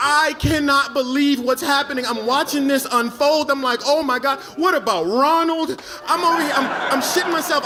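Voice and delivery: loud, high-pitched comic panic; panicked. Retold or imitated. Comic watching friend head for his dream woman, panicked. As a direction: wail it in rising disbelief.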